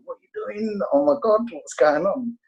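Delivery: a stiff father caught off guard by a hug; startled dad. Retold or imitated. His father, hugged for the first time, startled. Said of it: "He was like".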